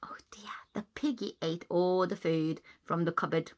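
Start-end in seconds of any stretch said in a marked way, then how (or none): none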